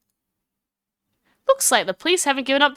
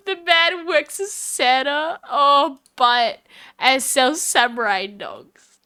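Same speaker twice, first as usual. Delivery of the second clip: ordinary talk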